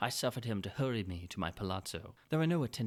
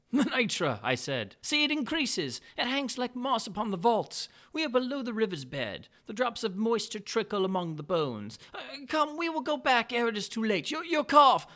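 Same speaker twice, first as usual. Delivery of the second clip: higher, louder, smoothly flattering nobleman; butter him up with mock delight; Montresor's sly greeting; 13 semitones higher, 7 dB louder than usual. Montresor points out the nitre.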